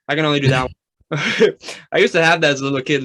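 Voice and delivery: normal talking voice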